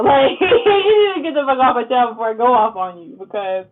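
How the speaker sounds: loud, laughing half-rant; play-tough warning, cracking up; mock-tough rant through laughter; laughing threat. Real, not acted.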